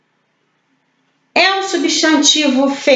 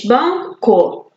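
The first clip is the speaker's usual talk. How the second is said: BANCO split as BAN, CO; syllable by syllable. Shown as a demonstration. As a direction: say Banco in two separate beats. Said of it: "dividida em duas sílabas".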